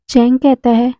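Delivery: ordinary delivery, nothing marked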